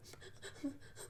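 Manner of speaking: a short choked sob, half mocked; retold sob. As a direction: break into a quick breathy sob while retelling. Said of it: "I just started sobbing".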